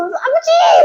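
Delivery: very high, drawn-out "father!"; hammed-up reunion cry; overjoyed son calling. Son answers father's joyful cry.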